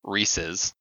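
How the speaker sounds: Reese's ending in a short -iz; pronounced REESE-iz. Shown as a demonstration. Co-host guessing the intended name, Reese's with short -iz. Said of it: "supposed to be".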